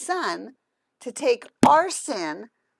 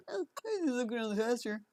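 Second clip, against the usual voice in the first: horse voice; 9 dB quieter, much faster than usual. Acted. Owner voicing her horse Hector.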